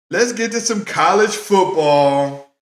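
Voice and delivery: hyped radio segment intro; announcer intro. Acted. Repeats it as a show segment intro.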